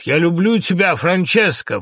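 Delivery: high, loud outburst of love; unable to hold back. Acted. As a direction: cry the confession out. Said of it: "Не выдерживает".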